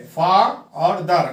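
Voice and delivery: "फा और दर" with each syllable set apart; emphatic teacher's split of "फा और दर"; syllables separated. Shown as a demonstration. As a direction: sound out फा, then दर, as separate pieces. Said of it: "इसके दो syllable है".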